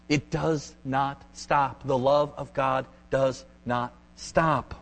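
each word set apart, slowly; deliberate word-by-word insistence with spaces between; slow, emphatic. Real, not acted. Preacher assuring church of God's love, slow and emphatic.

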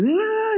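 high, muffled garbled holler; high-pitched imitation of a far-off yell; mock distant yell. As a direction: imitate someone hollering unintelligibly from across a street. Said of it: "this guy across the street yelling".

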